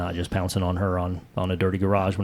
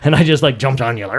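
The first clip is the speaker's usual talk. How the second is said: ordinary talk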